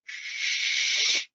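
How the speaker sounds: long, soft shush. Acted. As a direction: hush the demon in your head. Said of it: "And you go".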